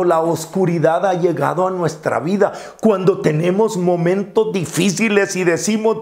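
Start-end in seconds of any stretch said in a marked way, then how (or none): none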